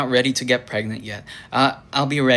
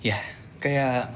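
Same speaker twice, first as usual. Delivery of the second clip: normal talking voice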